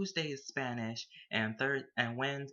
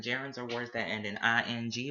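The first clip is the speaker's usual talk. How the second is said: usual speaking voice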